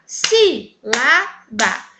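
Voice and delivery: "sílaba" split slowly into SÍ, LA, BA; syllable by syllable. Shown as a demonstration. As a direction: say "sílaba" in three separate, firm beats. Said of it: "Então, vamos lá". ("sílaba" separado devagar, sílaba por sílaba: SÍ, LA, BA)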